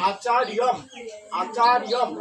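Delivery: আচার্যম said as a-cha-ri-yom; joined final m. Shown as a demonstration. Teacher joining m to the word, model pronunciation of আচার্যম. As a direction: close আচার্যম on "riyom", the m attached. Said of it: "তাহলে উচ্চারণ হবে".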